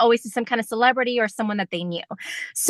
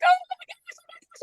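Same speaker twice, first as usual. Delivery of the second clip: much higher, breathless excited squeal; starstruck squeal. Retold or imitated. Fan being pulled on stage by the singer, excited squeal. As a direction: gush like a thrilled fan. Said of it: "and I'm like".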